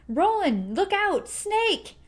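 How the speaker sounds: high, loud, urgent yell; a boy yelling a warning to his friend; scream of warning. A narrator's reading. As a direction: shout the warning at full pitch. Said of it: "Cuthbert screamed".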